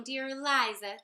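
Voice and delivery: usual speaking voice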